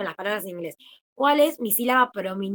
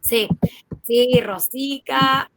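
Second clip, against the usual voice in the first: slow, louder, drawn-out sí, Rosica; delighted; 4 semitones higher, 6 dB louder, much slower than usual. Real, not acted. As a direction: gush over a beloved teacher, stretching the words. Fond reaction to favourite teacher. (entusiasmada y lenta, alargando sí, Rosica)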